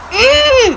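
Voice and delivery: loud, high-pitched displeased "mm"; upset hum. Retold or imitated. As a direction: hum your annoyance high and hard. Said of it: "'Cause she's upset".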